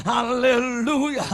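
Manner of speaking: ordinary talk, nothing marked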